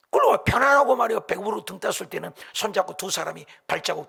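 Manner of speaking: acted-out aggrieved outburst; angry complaint. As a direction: argue it out with God, drawing out the grievance. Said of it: "막 화가 나서 주님께 따지고".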